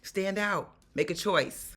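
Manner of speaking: usual speaking voice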